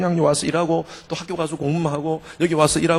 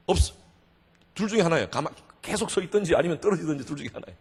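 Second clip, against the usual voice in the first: ordinary talk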